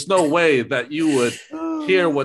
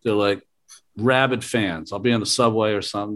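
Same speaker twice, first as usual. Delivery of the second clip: ordinary talk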